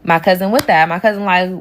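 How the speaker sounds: usual speaking voice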